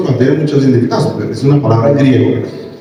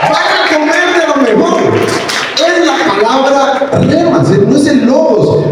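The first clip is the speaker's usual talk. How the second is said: rousing preacher shout; 11 semitones higher than usual. Real, not acted.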